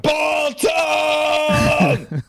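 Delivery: drawn-out, high yelled lyric parody; mock shout. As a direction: belt it out like a metal singer mishearing himself. Mock-yelling misheard Korn lyric.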